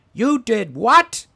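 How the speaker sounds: high, slow, shouted you did what; retold priest's outraged yell. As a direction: bellow it like a scandalised priest. Priest catches boy's confession.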